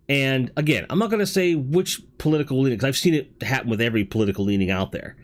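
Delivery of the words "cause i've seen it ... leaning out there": normal talking voice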